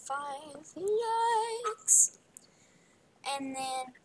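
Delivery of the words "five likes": very slow, rising high on FIVE LIKES; playful, long-held high "likes"; drawn-out plea. Pretend.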